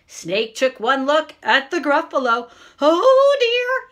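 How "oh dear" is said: high, loud mock fright; startled little cry, pitched up; alarmed. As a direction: yelp it in storybook dismay. Snake frightened by the Gruffalo.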